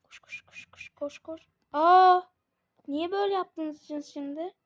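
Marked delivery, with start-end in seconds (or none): 0.0-1.4 whispering. Real, not acted. Urging character to run.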